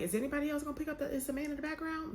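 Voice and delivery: high and fast, rushing out the question; fast, high disbelief. Retold or imitated. Viewer rushing to ask the comments, high and fast in disbelief. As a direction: blurt it out, high and quick in disbelief.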